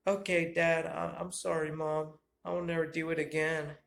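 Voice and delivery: put-on high, apologetic teen son. Son apologising to his dad, high and meek. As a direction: apologise meekly as the boy.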